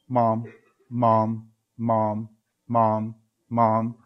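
lightly voiced small child, low and whining; whiny kid. A hint of a kid trailing behind his mom, whining. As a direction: nag like a little kid tugging at mom. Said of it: "a little kid walking behind his mom going".

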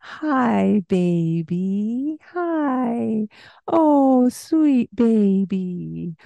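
high, slow, exaggerated cooing; baby talk. Shown as a demonstration. Parent showing baby talk, high and slow. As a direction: overdo every sound for the baby. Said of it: "the exaggerated pronunciation".

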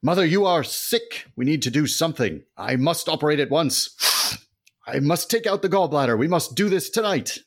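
high, loud hammy doctor voice; earnest doctor son. Acted. Son tells his mother he must operate, high and hammy. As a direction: deliver the diagnosis with melodrama.

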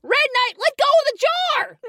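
high urgent yell; shouting. Acted. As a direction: yell across the chaos. Shouting during the struggle.

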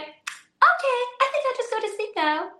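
put-on very high chirpy little boy; cheeky child. Son cheekily obeying mum, very high chirpy child. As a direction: chirp it like a smug kid. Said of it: "and he went".

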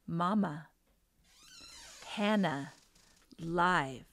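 lightly voiced toddler repeating herself, a pause after each word; slightly firmer little-girl statement; little child's voice. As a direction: patiently space out each word like a small child. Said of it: "her voice again".

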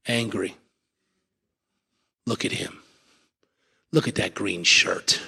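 low, soft, slow grumbling; sulky resentful mutter. Acted. Angry churchgoer eyeing a stranger, sulky mutter. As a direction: glare and mutter at the man.

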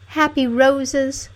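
high, slow princess voice; wistful princess. A narrator's reading. Princess crying under a rose bush, wistful.

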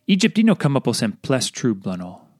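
usual speaking voice

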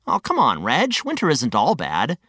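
ordinary delivery

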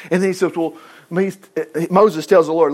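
ordinary delivery

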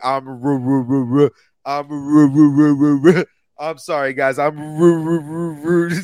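stuck stammer, rising loud; sputtering engine. Retold or imitated. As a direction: sputter on ru like a stalling motor. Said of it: "You're gonna be like".

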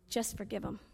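soft, low, hushed counsel; gentle prompting. Acted. Holy Spirit urging forgiveness, gentle prompting. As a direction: say it very softly, leaving space around it.

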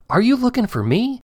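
put-on boy hidden in corn asking, small voice. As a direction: ask it innocently, tiny and high. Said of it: "the small voice said".

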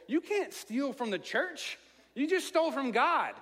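mock horror at a friend who stole from God; retold outburst. Friend Harris scolds the narrator for stealing from church, in a mild mock outrage. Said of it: "he lost it".

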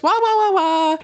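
very high wah-wah whines; high dog whimper wahs; dogs whining. Pretend. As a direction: whine like a shivering pup. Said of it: "You can almost hear 'em say it in their voice".

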